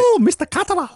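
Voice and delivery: put-on high-pitched caricature of a Chinese accent; mock Chinese accent. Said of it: "She's not fucking Chinese".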